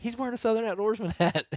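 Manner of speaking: high, bursting with a laugh, amazed; excited disbelief. Retold or imitated. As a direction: blurt it out, giddy, half laughing. Retelling spotting a listener's hat.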